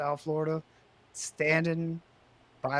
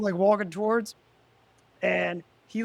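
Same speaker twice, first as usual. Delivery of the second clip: ordinary delivery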